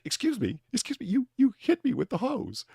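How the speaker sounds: high, incredulous British protest; incredulous British accent. Retold or imitated. Priest telling the hose man off in a high incredulous British accent. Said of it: "The incredulousness of the accent".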